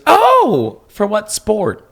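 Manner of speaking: high, loud, drawn-out "oh"; gently surprised churchwoman. Retold or imitated. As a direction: imitate a well-meaning woman's high-pitched surprise. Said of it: "She wasn't, like, judgy, but she goes".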